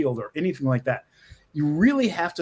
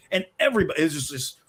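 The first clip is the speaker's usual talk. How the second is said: ordinary delivery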